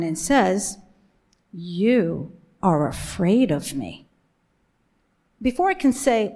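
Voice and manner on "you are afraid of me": low, slow, menacing retelling; stern angry woman. Retold or imitated. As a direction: imitate a stern woman, drawing out each word slowly. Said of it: "a stern, angry woman stares me down".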